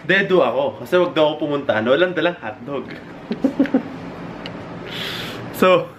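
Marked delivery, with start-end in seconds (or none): 3.0-5.6 high giggle. Real, not acted. Laughing at own story.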